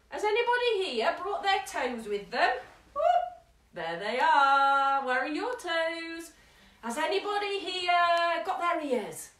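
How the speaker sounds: playful clowning for toddlers; hammed-up silly game voice.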